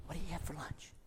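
put-on whispered question to a child; gentle whisper. [name] quietly asking the little boy about his lunch, whispered. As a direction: hush it like asking a shy child.

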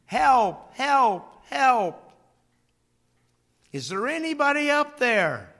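high, loud cries for help; yelling for help. Retold or imitated. Man hanging from cliff, yelling for help. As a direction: shout up the cliff for rescue. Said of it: "he begins to yell".

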